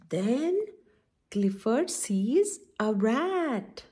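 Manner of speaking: slow, drawn-out storybook reading; reading to children. Acted. Auntie D reads Clifford aloud to the children, slow storybook voice. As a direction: read each line slowly, as if to little kids.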